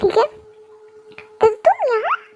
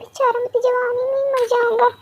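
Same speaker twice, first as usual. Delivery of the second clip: ordinary delivery, nothing marked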